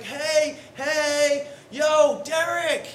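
high, loud call across the room; eager greeting shouted from afar. Retold or imitated. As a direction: hail him from a distance. Acquaintance calling out across room.

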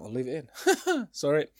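embarrassed laugh through the words. Real, not acted. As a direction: laugh it off sheepishly. Laughs after burping on camera.

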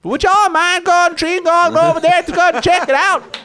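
put-on high, loud old-timer yokel; old prospector. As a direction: holler like a crusty old prospector. Sheriff character asks for help.

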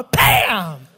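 loud, drawn-out BAM; explosive comic impact word; pretend smack. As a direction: shout it as a punch landing. Imitates smacking the man.

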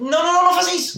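very high, loud hammed-up shriek; mock panicked plea. Friend imagining a dying person's protest, high loud mock shriek.

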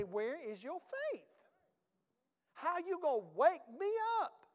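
acted exasperation, climbing high on the second question; indignant complaint at being woken; aggravated. Jesus rebuking disciples in the storm, aggravated. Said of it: "Jesus got real aggravated".

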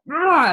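loud, high roar of abandon; wild passionate "ah". Retold or imitated. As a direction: let out a full-on, raw "ah". Imitating wild, full-on passion.

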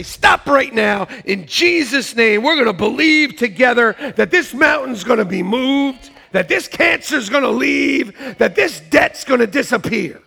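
loud, emphatic preacher's prayer; commanding declaration. Acted. Preacher modelling an agreed prayer against sickness and debt, loud and commanding. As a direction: declare it forcefully, punching each phrase.

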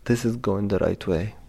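ordinary delivery

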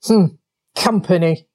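short, unimpressed grunt. A narrator's reading. Badger on seeing company by the river, grunting. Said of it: "then grunted".

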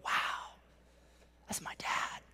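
whispered, very soft wonder; hushed awe. Retold or imitated. Kids looking up at their dad, hushed awe. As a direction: breathe it out as an awed whisper. Voicing his admiring kids.

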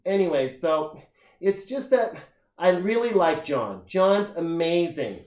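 high, loud, drawn-out girly imitation; chatty bar girl. Girl at the bar going on about John, mock gushing voice. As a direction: gush slowly as a mocked bar girl. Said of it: "With a voice like that".

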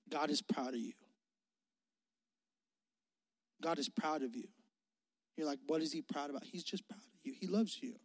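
soft, quiet preaching to the students; tender preaching. Acted. Preacher softly assuring students God is proud, quietly.

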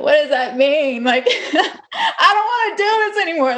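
high, slow, half-laughing disbelief; mock distraught, laughing; laughing despair. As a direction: laugh through the hopelessness. Retold despair after cancer news.

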